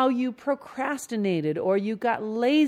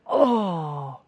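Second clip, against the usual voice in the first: acted exasperated self-reproach; scolding inner voice. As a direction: chide yourself in disgust. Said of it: "here comes the inner voice".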